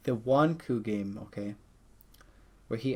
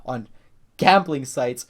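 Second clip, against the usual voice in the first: ordinary talk, nothing marked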